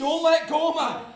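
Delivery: loud, high plea; begging a friend not to let go; desperate shout. Acted. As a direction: yell it in panic. Begging in the fire.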